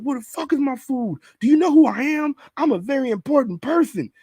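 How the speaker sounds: high mock yell of a rude customer. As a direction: play the entitled diner demanding his food. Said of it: "He was like".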